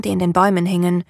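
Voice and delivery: usual speaking voice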